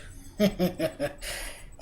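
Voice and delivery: a soft, breathy villain's laugh; sly throaty snicker; throaty evil chuckle. Acted. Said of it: "the Grinch laughed in his throat".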